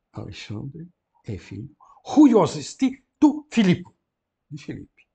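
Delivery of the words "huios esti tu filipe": grego declamado, alto e agudo; leitura em voz alta. Shown as a demonstration. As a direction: anuncie a frase à turma. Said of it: "reads Greek aloud for class". (o professor declama o grego à turma, alto e agudo)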